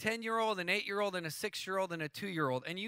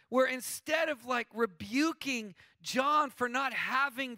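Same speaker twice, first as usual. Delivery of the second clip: full-force preaching; 7 semitones higher than usual. Acted. Pastor to congregation, preaching at full force. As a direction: preach it hard to the crowd.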